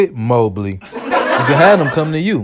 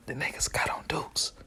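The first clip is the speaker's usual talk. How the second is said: hushed secret whisper; whispered warning; 3 semitones lower, 19 dB quieter than usual. Retold or imitated. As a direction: lean in and whisper it. Warning guy on couch.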